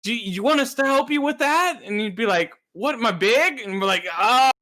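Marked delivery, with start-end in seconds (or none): 0.0-1.8 high, bright mock offer of help; mock helpful voice. Friends offering help, mock quote. 2.7-3.6 high mock indignant question; mock offended. Retold offended reply. 4.2-4.5 high, loud flustered stammer; flustered backpedal. Retold or imitated.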